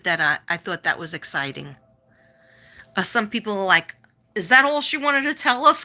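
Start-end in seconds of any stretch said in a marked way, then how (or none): 4.3-5.8 higher, louder mock complaint; imitating unimpressed viewers. Let-down viewers griping about the stream, mock complaint.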